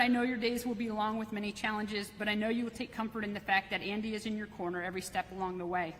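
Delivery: normal talking voice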